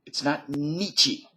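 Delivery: "Nietzsche" said NEE-chee, slow; mock the wrong "Nietzsche" ending in ee; wrong pronunciation. Shown as a demonstration. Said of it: "that's the correct pronunciation".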